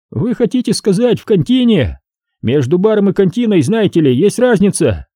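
high, needling cop voice; mocking detective, high voice; sly cop Ramos. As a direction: poke fun at the suspect, pitched high.

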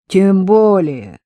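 a mild, pleased, slow «Тем более». A narrator's reading. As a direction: say it with a touch of glee at being proven right. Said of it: "обрадовался санитар".

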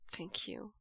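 soft quiet thank you. A narrator's reading. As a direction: say it under your breath, sincere. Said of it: "Wei Ying says quietly".

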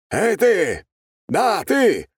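high, loud call from behind; loud shout. A narrator's reading. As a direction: call him out across the crowd. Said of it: "сзади раздался крик". (громкий окрик)

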